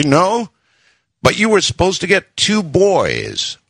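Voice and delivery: high-pitched, forced sweet reminder; mock-loving voice with teeth gritted; falsely sweet through gritted teeth. A narrator's reading. Husband reminds wife about two boys, falsely sweet. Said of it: "in my most loving, calm, sweet voice".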